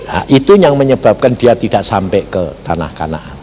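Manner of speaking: ordinary talk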